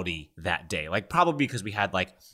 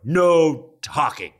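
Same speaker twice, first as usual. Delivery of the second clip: loud, high, stretched-out command; lunch attendant's order; 6 semitones higher, 8 dB louder, much slower than usual. Acted. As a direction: call the rule across a lunchroom, very slowly. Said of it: "the tenants would say".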